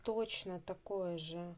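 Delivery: usual speaking voice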